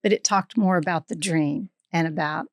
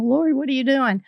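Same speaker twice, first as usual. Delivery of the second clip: higher-pitched teasing call; playful singled-out needling, pitched up; 5 semitones higher than usual. Retold or imitated. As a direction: imitate a man poking mild fun. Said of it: "sort of make fun of me".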